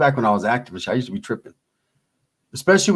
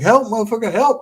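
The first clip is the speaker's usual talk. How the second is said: mock desperate cry for help; 8 semitones higher than usual. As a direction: yell for help like you're pinned down in the game. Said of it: "I'm like".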